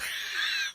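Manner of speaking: a quick breathless giggle, nearly silent. Real, not acted. Wife giggling while telling the frog story, a breathless giggle. Laughing at her own story.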